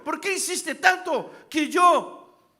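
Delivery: ordinary talk, nothing marked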